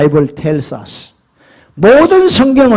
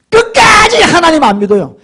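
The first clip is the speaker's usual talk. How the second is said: ordinary delivery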